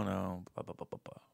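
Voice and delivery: soft whispered blah blah blah; dismissive mutter. Retold or imitated. Mocking his wife's brush-off in a whisper. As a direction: brush it off under your breath.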